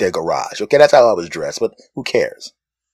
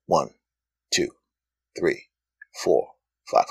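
slow count; 9 semitones lower, 9 dB quieter than usual. Shown as a demonstration. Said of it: "this will give you an idea".

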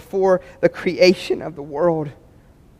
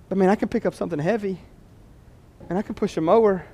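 ordinary talk

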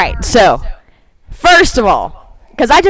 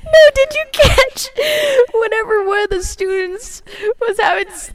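high-pitched, helpless giggling while talking; laughing hard; 9 semitones higher than usual. Real, not acted. Co-host retelling the sexy time scene, laughing hard. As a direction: struggle to get the story out through laughter.

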